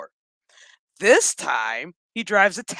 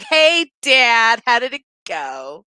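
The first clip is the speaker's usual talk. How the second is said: hammed-up daughter voice; daughter greeting Dad; 2 semitones higher, 8 dB louder, slower than usual. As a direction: imitate a daughter's exaggerated greeting, stretched out.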